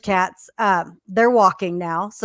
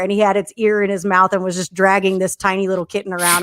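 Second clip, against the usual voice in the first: usual speaking voice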